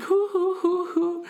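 very high, hooting mock laugh; imitated Polynesian laugh. Raffle lady laughing at him, high imitated hooting laugh. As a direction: imitate her laugh as a string of piping hoots. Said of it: "she started doing a Polynesian laugh".

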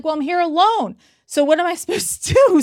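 high, helpless retold plea with a laugh; exasperated protest. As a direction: protest as if stranded, voice climbing.

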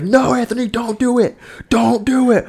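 high, hammed-up crowd cry of don't do it; imitating the crowd. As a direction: warn him off like the whole party is begging. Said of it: "Everyone's like".

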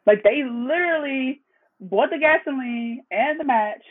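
ordinary talk, nothing marked

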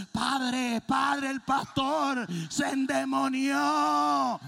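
high, loud, slow alarmed cry; panicked church sister. Retold or imitated. Church sister seeing the pastor bite the dog, slow loud alarmed cry. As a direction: call for the priest in horror. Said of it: "la hermana dice".

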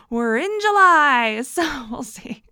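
slow words through laughter; chuckling through the update; laughing. Real, not acted. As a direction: laugh as you say it, amused at the wait. Laughing about the car delay.

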